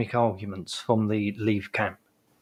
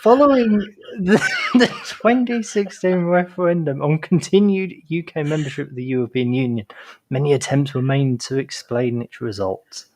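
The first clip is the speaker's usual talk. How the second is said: reading through suppressed laughter; fighting back giggles while reading; stifled giggles; 3 semitones lower than usual. Real, not acted. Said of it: "trying not to laugh while I'm reading".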